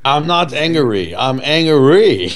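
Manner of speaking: put-on Chinese accent, "Angry" said for his name; hammed-up Chinese accent mixing up angry and Ang Lee; mock Ang Lee accent. Ang Lee correcting his name, mock Chinese accent. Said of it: "impression of Ang Lee".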